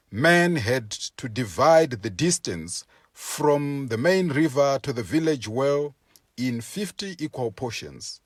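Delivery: usual speaking voice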